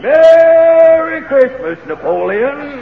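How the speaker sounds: high, slow shouted Merry Christmas; booming Santa greeting. Acted. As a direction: belt it out like Santa. Santa bursting through fireplace.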